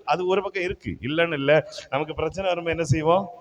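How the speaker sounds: usual speaking voice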